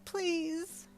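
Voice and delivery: high tearful begging; sobbing request; sobbed plea. Acted. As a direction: beg through sobs. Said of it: "you sobbed".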